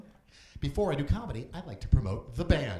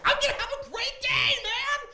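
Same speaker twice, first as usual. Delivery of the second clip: high, cheerful put-on voice; like a guy beaming after a catch; mock cheerful; 6 dB louder than usual. Comic imitating a man catching his keys, high and cheerful. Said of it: "you're like".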